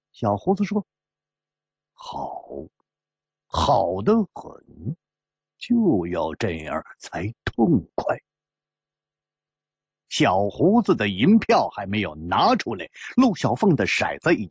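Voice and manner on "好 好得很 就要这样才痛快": cool rival gambler. Acted. Rival accepting an all-in bet, cool and deliberate. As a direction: draw it out low and measured.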